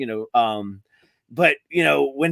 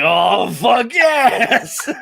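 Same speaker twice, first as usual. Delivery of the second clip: drawn-out, higher, laughing shout; excited yes; 6 semitones higher, 3 dB louder, much slower than usual. Retold or imitated. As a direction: cheer it out slowly with delight. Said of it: "I'm like".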